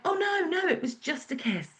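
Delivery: high, hurried oh no, soft at the end; flustered correction. Retold or imitated. Friend blurts the correction to the group, high then hushed.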